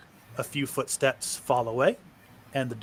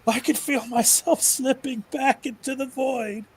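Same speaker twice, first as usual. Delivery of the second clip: high, desperate pleading wail; a broken man begging his master to stop; 11 semitones higher, 3 dB louder than usual. Acted. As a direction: beg in terror, voice pitched way up. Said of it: "Mark starts wailing".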